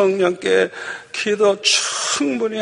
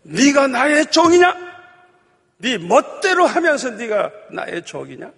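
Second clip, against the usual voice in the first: raised, high retold scolding; stern rebuke; 8 semitones higher, 3 dB louder than usual. The Lord rebukes his self-willed servant, sternly. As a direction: challenge a disobedient servant forcefully. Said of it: "주님이 책망을 하시는데".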